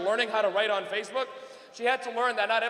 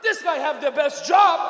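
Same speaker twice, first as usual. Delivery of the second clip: loud, slow, raised-pitch dad voice; awestruck father; 4 semitones higher, 7 dB louder, slower than usual. Retold or imitated. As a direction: marvel as an impressed Dad, drawing it out. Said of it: "And he went".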